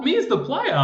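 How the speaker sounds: high, loud laughing disbelief; incredulous. Retold or imitated. Gamer stunned that the boss mocks him, incredulous. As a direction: squeak it out amazed and laughing. Retelling his disbelief at the taunt.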